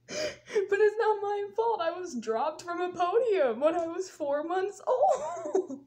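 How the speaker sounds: slow, drawn-out whine through laughter; laughing protest. Real, not acted. Storyteller defending her stupidity, laughing. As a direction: plead your case while cracking up.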